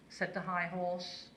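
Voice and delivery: usual speaking voice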